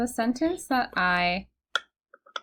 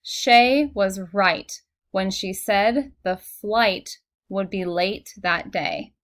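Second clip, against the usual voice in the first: slow, careful dictation pace; slow reading; 5 dB louder, slower than usual. Shown as a demonstration. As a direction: read slowly, word by word. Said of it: "the sentence that I typed earlier was".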